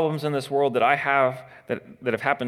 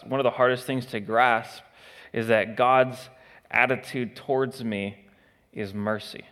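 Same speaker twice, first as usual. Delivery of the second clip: ordinary delivery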